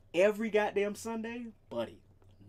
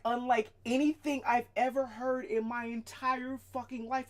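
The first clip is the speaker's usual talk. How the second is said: ordinary talk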